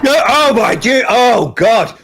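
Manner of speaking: high, loud, cringing; loud cringe reaction; loud cringing groan. Real, not acted. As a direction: wince out loud.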